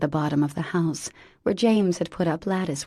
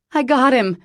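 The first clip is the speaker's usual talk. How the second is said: loud, high call over the shoulder; 8 semitones higher, 9 dB louder than usual. Acted. Mother tells the crowd she found him, loud call. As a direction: shout the good news back. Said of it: "she called over her shoulder".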